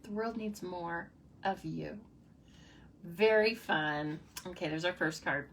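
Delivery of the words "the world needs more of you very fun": ordinary talk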